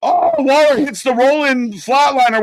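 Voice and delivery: high, loud hammed-up fan squeal; mock screaming toxic fan. Mocking a toxic fan who screams every move, high and loud. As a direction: squeal it like an overdramatic wrestling fan. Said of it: "just screams to make a point as".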